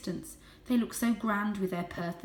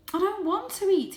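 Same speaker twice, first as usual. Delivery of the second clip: high, louder protest; pleading; 12 semitones higher, 6 dB louder than usual. A narrator's reading. Varjak protests to his family, high and urgent. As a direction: plead to be believed. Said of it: "he tried to explain".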